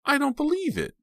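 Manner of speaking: high, loud disbelief; shocked cry. A narrator's reading. Pam facing her attacker, cry of disbelief. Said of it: "she cried".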